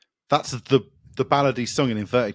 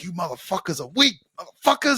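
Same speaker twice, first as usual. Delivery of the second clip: mock drill sergeant; 7 semitones higher than usual. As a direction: play-act an aggressive drill sergeant yell. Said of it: "it's just aggressive and cool".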